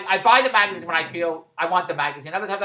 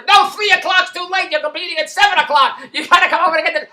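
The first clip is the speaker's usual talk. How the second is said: much higher, louder mock outrage; exasperated protest; 8 semitones higher, 8 dB louder than usual. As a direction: squawk that five is too late. Mother of the kids cutting him off.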